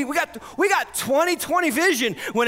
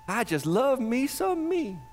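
self-admiring mock; 4 dB quieter, slower than usual. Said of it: "Are you full of you?".

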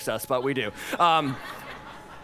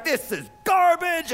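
disgusted chef; 8 semitones higher, slower than usual. Retold or imitated. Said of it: "he tastes the food and he's like".

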